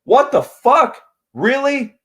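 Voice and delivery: high, loud outburst of disbelief; shocked disbelief. Pretend. Fan in a theater reacting to a surprise cameo, shocked and loud. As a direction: blurt it out, stunned in the theater seat. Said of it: "where I would just be sitting there and like".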